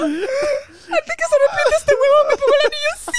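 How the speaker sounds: high, loud crying joke; snatch the ring through happy tears; tearful eager yes. Retold or imitated. Retold eager acceptance, crying.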